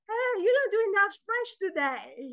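high, protesting audience voice; mock complaint. Audience to the poet after a show, complaining. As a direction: imitate a let-down fan, pitched well up.

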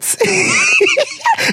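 high shrieking burst of laughter. Real, not acted. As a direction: crack up with a squealing laugh. Laughs at the name Margaret.

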